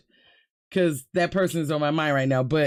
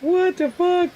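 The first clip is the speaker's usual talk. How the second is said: high, louder stunned exclamation; shocked outburst; 11 semitones higher, 4 dB louder than usual. Real, not acted. Seeing the ghost clip, a high shocked outburst. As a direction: blurt it out in disbelief.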